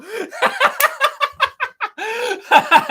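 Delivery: high, loud fit of laughter; long hard laugh. Real, not acted. Streamer cracking up at replies about the wager, high laughter. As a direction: crack up completely, pitch shooting high.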